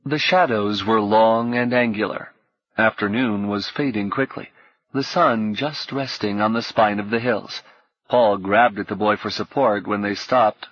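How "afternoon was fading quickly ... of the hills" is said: ordinary delivery, nothing marked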